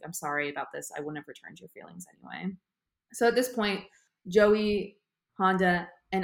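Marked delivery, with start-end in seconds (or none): 0.0-2.6 softer, lower mock apology; quiet apology. Yugi apologizing to Risa, soft and quiet. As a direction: murmur it gently as a retold aside. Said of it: "he's quietly apologizes to her".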